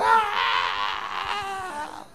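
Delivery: high startled scream; mock scream of fright. Disciples seeing the bogeyman's horns, a mock scream of fright. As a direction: shriek at the bogeyman.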